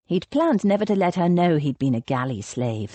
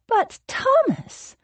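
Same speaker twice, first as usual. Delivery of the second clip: much higher, slow protest; pleading Rose; slower than usual. A narrator's reading. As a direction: drag the plea out.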